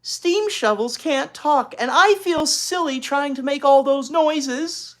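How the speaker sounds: high, loud, slow complaint; exasperated sister. Acted. Older sister complaining to her little sister. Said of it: "Beezus asked".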